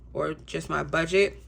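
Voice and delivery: usual speaking voice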